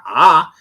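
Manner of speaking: ah opened wide and held; A sound. Shown as a demonstration. Teacher demonstrating the A tone, open ah. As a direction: say ah from the throat. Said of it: "So you have the A sound".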